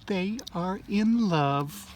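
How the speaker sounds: slow, soft put-on voice; character voice. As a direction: say it slowly, gently. Friend voice, slow.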